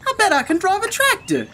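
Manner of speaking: high, quick duck voice; Duck's boast. A narrator's reading. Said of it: "he said".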